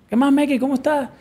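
caller's greeting. Retold or imitated.

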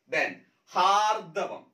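ഹാർദവം said very slowly, each syllable ഹാർ-ദ-വം spelled out clearly; careful spelling demo. Shown as a demonstration. Teacher to exam students, slow clear ഹാർദവം. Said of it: "നമ്മൾ ഹാർദം എന്ന് പറയും".

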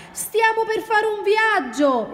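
high, drawn-out excited cry. A narrator's reading. Ranocchio exclaims as the trip begins, excited. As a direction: exclaim it high and slow with delight. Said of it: "esclamò Ranocchio eccitato".

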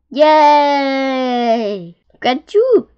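high, loud cheer; old beetle cheers the young one's landing, thrilled shout; excited shout. A narrator's reading. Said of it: "shouted the wise old click beetle".

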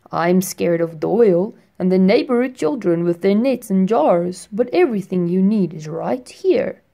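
put-on firefly greeting the boy at his window; friendly firefly. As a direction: greet him like a gentle old friend.

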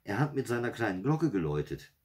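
put-on high, excited child; worked-up girl reporting eagerly. A narrator's reading. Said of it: "sagte Janina aufgeregt".

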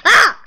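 sudden loud high scream; startled scream. Real, not acted. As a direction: shriek in fright. Startled again.

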